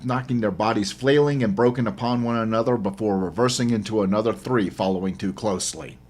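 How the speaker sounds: ordinary delivery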